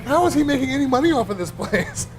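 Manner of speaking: high, laughing girlfriend impression; mock girlfriend. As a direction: squeak it out through laughter like a baffled girlfriend. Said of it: "She's like".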